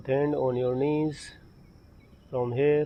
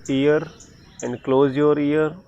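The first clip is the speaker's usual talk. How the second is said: calling out louder; 5 dB louder than usual. Real, not acted. Calling out to class with ears closed.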